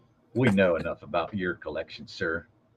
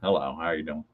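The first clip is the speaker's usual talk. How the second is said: low, softer Southern voice; Southern accent; 5 semitones lower, 5 dB quieter, faster than usual. Pretend. Answering the co-host's hello in a low Southern accent. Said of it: "my Southern voice".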